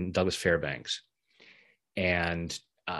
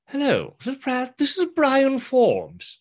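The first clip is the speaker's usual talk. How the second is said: much higher, slowed, posh British phone greeting; 5 dB louder, slower than usual. Retold or imitated. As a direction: put on a British gentleman answering the phone. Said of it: "there's this British voice saying".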